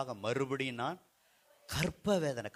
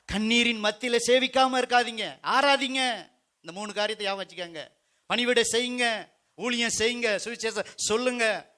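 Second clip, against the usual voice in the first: high, urgent pulpit exhortation; preaching exhortation; 7 semitones higher, 5 dB louder than usual. Real, not acted. Preacher urges believers to serve amid tears, exhorting. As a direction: urge the congregation on in a raised pitch.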